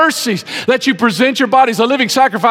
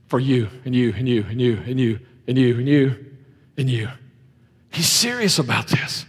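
solemn hushed preaching; 6 semitones lower than usual. Acted. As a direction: bring them down to a reverent hush. Solemn low preaching on blood.

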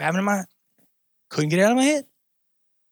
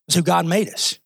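ordinary talk, nothing marked

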